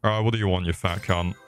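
ordinary delivery

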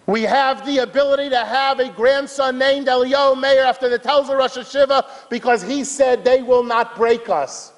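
high, sustained sermon delivery; fervent high-pitched testimony; impassioned preaching. Real, not acted. Preaches with raised intensity.

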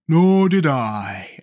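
very slow, stretched character voice; lingering put-on voice; pumpkinhead Jack. A narrator's reading. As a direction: draw it out as a simple pumpkinhead. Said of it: "added Jack".

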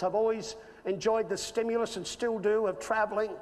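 ordinary talk, nothing marked

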